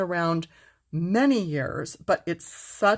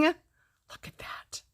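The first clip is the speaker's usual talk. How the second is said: slow, hushed whisper; slow reverent whisper; awed whisper; 12 semitones higher, 4 dB quieter, much slower than usual. Real, not acted. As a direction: breathe it out in wonder. Marveling at finished gradient.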